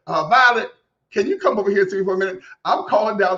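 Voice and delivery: [name] twang. Retold or imitated. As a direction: call down the hall like Dolly in Nine to Five. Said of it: "Miss Violet Newstead talking to Dora Lee".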